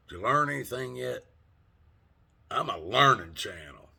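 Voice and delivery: usual speaking voice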